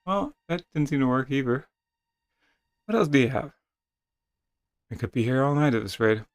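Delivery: normal talking voice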